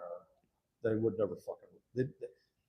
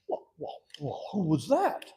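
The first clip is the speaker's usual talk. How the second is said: slow probing question; 6 semitones higher, 6 dB louder, slower than usual. Retold or imitated. Councilman asks, put-on voice.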